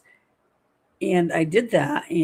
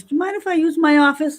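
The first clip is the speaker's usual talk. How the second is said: much higher, louder sing-song ask; mock sweet request; 8 semitones higher, 6 dB louder than usual. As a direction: ask it in a sugary high voice. Asking kitchen ladies, retold.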